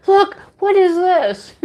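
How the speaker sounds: high, drawn-out mock amazement; amazed calling over. Shopper showing Angie some yarn, amazed calling over. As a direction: marvel at a find, stretching the words.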